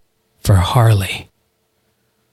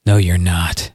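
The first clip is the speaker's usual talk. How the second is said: low, quiet, cold reply; quiet cold hero; 7 semitones lower, 4 dB quieter than usual. Acted. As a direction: dismiss him coldly. Hero calmly answers the curse.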